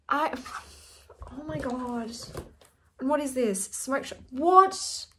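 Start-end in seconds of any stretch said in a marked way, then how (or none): none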